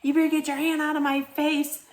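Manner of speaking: hammed-up defensive snap; imitated angry retort. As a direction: snap back at her to back off. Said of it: "she was like".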